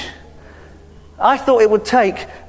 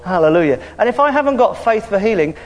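ordinary talk

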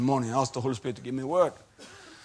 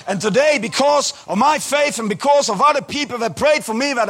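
loud, high preaching voice; fervent testimony; 12 semitones higher, 10 dB louder than usual. Retold or imitated. Healed man testifying to his doctor about Jesus, preaching loudly. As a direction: proclaim it to the doctor like a sermon. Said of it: "And I'm saying like this".

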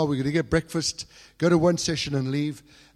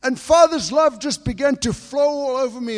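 louder, higher, impassioned sermon build; building fervent preaching; 8 semitones higher, 7 dB louder than usual. Acted. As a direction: preach it with growing passion. Preaches with rising fervour.

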